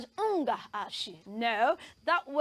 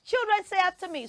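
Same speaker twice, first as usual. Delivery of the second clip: brisk, higher teacher prompt; classroom teacher call; 5 semitones higher, faster than usual. Shown as a demonstration. Teacher calling children to repeat after her. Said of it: "teacher leads repetition".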